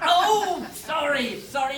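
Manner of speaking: ordinary talk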